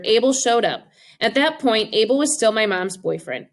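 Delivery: ordinary delivery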